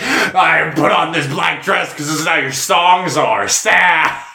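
hammed-up lament in a put-on voice; mock-dramatic singer. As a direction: wail it with playful melodrama.

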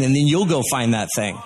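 normal talking voice

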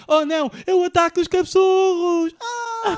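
high, loud mock panic; panicked cry. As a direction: shriek like a terrified villager. Acting out panicked islanders.

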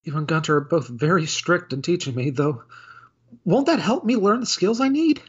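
a timid maid caught out, sorry and panicking; flustered clumsy maid. Acted. Maid Felicia apologises to butler Jacob and then drops the snacks, in a high flustered voice.

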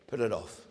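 low, flat command, PUT hit; low firm retort. Acted. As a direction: cut the excuse short.

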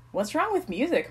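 mildly affronted; demanding. A narrator's reading. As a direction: challenge the complaint. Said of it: "Mike demanded".

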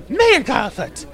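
high-pitched shocked scolding; scandalized exclamation. Acted. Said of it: "Shotha looks like skin light".

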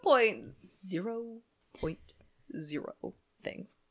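"zero point zero" slowly spaced out, fading; drawn-out emphasis. Real, not acted. Scolding co-host for getting nowhere, slow pointed emphasis. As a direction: stretch each "zero" and leave long gaps. Mock tally of what was discussed.

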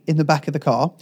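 ordinary delivery

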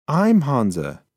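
a hint of a small mouse insisting; insistent mouse. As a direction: say your name firmly. Mouse insists on his name.

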